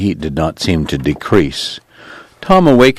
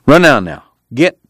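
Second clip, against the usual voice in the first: louder, higher shoo; 7 semitones higher, 5 dB louder than usual. A narrator's reading. Tom shooing his staring sister off, loud. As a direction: send her off with a sharp shoo.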